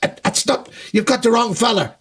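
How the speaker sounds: high, flustered put-on voice; flustered singer. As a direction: brush off the heckler awkwardly. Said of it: "[name] at one point just".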